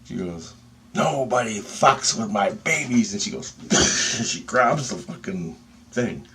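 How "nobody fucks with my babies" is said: slow, higher, emphatic warning; protective fury. Retold or imitated. Lady of light defends her babies, slow and fierce. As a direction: act out a fierce mother's threat.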